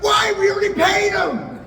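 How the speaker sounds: high, loud indignant yell; mock outraged shout. Groom yelling at wife that the band was paid. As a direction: shriek the complaint.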